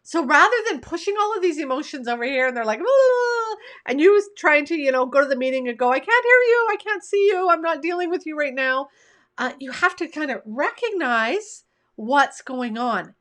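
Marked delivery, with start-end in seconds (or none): 2.8-3.6 high, loud "aaah" of clamouring emotions; mock wail. Coach voicing emotions pushed aside, loud mock wail. 5.9-8.9 high, loud denial, easing down by the end; mock refusal. Coach acting out ignoring emotions at a meeting, mock refusal.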